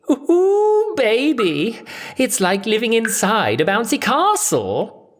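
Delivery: very high, gleeful squealing voice; delighted Cassandra. Acted. Cassandra squeals with joy at the mirror. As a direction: squeal it like a giddy hostess.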